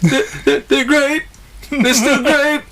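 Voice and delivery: high, halting mascot lament; mock-pathetic cartoon tiger; forlorn tiger in rain. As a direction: stammer into a pitiful high catchphrase. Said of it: "he's like in the rain".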